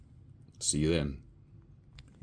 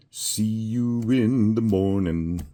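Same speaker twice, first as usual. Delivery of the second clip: slow sing-song goodbye; 4 semitones higher, 5 dB louder, slower than usual. Acted. Drawn-out sign-off.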